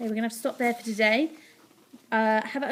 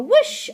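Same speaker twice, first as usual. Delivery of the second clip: high, loud whoosh; quick excited burst of rushing air; flying whoosh; 12 semitones higher, 8 dB louder than usual. A narrator's reading. Narrator sends Ned flying over the castle, loud whoosh.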